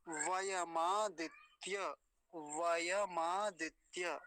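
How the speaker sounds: वयमादित्य drawn out very slowly, syllable by syllable; slow syllable demo. Shown as a demonstration. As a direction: stretch वयमादित्य out for learners. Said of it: "देख लीजिए आराम से". (वयमादित्य बहुत धीरे, एक एक अक्षर)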